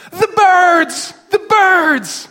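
put-on very high, loud child's wail; wailing little girl. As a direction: imitate the sobbing daughter. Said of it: "Who is dead?".

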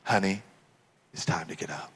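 low, soft wake-up voice; gentle parent. Acted. Parent getting children up for church, gentle and soft. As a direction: coax a sleeping child, hushed. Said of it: "what any parent possibly said to their little children".

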